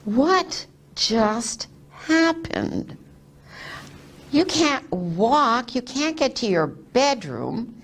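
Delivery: slow, drawn-out disbelief, acted out; astonished mock questioning, pitch leaping at the end; incredulous husband. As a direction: imitate a dumbfounded husband, stretching the key words. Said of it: "my husband is looking at me like".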